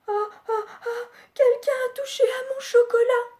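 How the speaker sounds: a high, dismayed cry; Mama Bear exclaiming. Acted. Mama Bear finding her chocolate touched, high wail. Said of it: "elle s'exclame".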